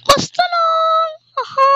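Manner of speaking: high, loud, slow put-on voice; teacher voice. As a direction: bark it out as the teacher. Sir Pinocchio answers.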